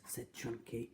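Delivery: ordinary delivery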